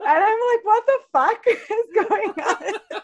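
laughing. Real, not acted. Laughing while retelling shocked reaction.

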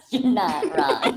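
amused, drawn-out repeat through laughter; laughing repeat. Real, not acted. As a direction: savour the line while cracking up. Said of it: "repeated while laughing".

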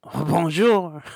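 high, loud hammed-up French accent. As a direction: greet like a French deli worker. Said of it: "I'll speak in a French accent".

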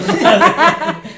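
sudden loud burst of laughter. Real, not acted. Friends joking about a dangerous neighborhood, loud laugh. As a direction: cracks up loudly, can't hold it in.

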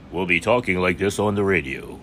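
smooth radio announcer voice. Shown as a demonstration. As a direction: put on a polished on-air voice. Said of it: "no more nasally [name] voice".

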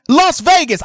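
loud, high shout-out to the city; calling out. Real, not acted. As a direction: call out Vegas with energy. Radio host calling out to city.

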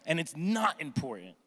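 ordinary talk, nothing marked

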